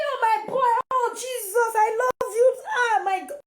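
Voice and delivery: mother overjoyed at good report, half crying; over-the-top excitement. Acted. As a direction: gush like it's the best news ever. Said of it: "Show that excitement to am".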